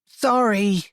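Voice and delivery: put-on high sheepish child apology; a meek little boy saying sorry; small child's voice. A narrator's reading. Child apologizing after swallowing.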